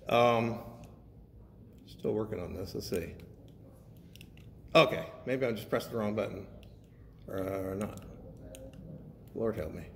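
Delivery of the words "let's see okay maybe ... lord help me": ordinary talk, nothing marked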